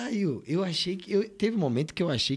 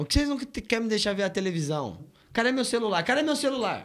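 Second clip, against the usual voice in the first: higher, demanding where your phone is; patient upset with the staff, higher voice; high complaining; 6 semitones higher than usual. Acted.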